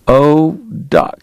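usual speaking voice

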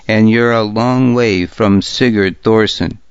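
ordinary delivery

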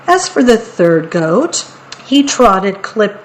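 usual speaking voice

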